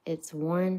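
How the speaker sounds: plain reading voice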